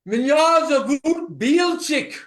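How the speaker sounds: slow, loud mock scream. Shown as a demonstration. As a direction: yell the name out slowly. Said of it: "Now, scream".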